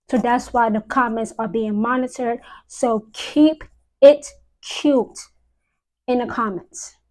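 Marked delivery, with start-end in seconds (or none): 2.7-5.2 slow, separated words, punching IT and CUTE; a deliberate warning, pausing before "it" and "cute"; pointed warning. Real, not acted. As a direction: lay down the rule word by word. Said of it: "I have to say it like that".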